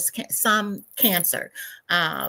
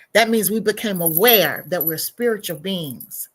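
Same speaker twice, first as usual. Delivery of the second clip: ordinary talk, nothing marked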